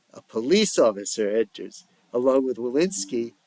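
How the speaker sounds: ordinary delivery, nothing marked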